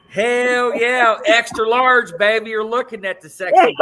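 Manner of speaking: high, loud, fast playful boast; loud sassy brag. Acted. Joking about being extra large.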